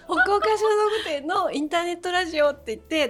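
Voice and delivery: 笑い泣き. Real, not acted.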